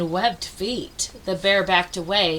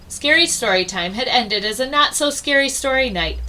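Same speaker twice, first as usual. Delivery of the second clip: ordinary delivery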